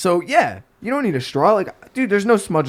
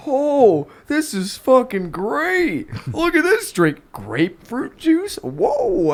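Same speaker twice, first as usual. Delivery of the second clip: high-pitched gushing over a drink; mock amazed customers; 9 semitones higher, much slower than usual. Bartender imitates customers raving about drinks, mock amazed. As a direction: imitate an over-impressed bar customer, drawn out. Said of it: "People are like".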